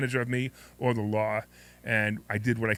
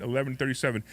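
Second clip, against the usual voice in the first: normal talking voice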